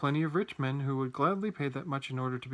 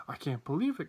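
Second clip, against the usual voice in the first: ordinary delivery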